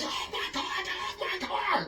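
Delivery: high, strained mock shout at normal volume; imitated yelling. Cab driver to a guy grabbing his car, mock yelling. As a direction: squawk it like a panicked driver, half-voiced. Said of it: "he started yelling at him".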